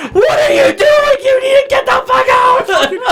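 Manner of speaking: very high, screechy mock yell; hammed-up shrieking daughter. Daughter phoning dad at the motel, shrieking mock fury. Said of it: "his daughter picks up the phone and is like".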